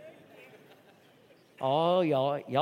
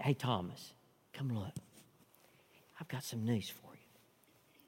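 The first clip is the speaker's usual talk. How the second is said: whispered Jesus; 11 semitones lower, 18 dB quieter than usual. Acted. Jesus calling Thomas over, whispered. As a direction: lean in and whisper the news. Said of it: "he said".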